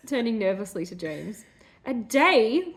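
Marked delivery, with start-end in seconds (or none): 1.8-2.7 a crying, higher, louder cry of A day; Corey crying out in disbelief at the wait. Acted. Corey in tears, cries out loud and higher. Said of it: "cried Corey".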